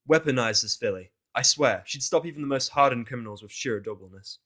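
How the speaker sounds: ordinary delivery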